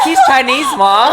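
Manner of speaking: very high, laughing squeal; laughing exclamation. Retold or imitated. Telling mom about the boyfriend, high laughing exclamation. Excited retelling of introducing boyfriend.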